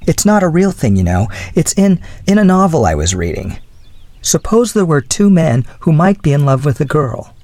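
lightly voiced young woman making excuses; flustered young woman. A narrator's reading. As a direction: lift the voice for a flustered girl.

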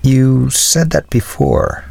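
slow, measured reminder; patient. A narrator's reading. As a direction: point it out patiently, drawing the words out. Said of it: "the philosopher noted patiently".

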